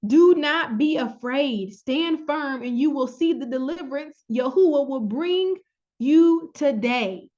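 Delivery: slow, forceful reading of the verse; scripture reading. Acted. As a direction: proclaim it slowly, like Moses.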